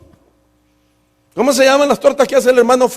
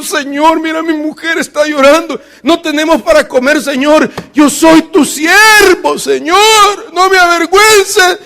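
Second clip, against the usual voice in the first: higher, louder pleading cry to the Lord; desperate prayer; 6 semitones higher, 3 dB louder than usual. Retold or imitated. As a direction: beg heaven out loud, hands raised. Desperate prayer, hands raised.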